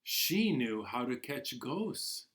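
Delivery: gentle storybook narration, unhurried; reading to children. Acted. Teacher reading Ghosts in the House to class, storybook voice. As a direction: draw the little ones into the tale. Reads picture book to children.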